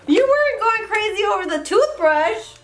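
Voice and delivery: high, loud squealing coo. Real, not acted. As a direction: tease the puppy in a squeal. Excited baby talk to dog.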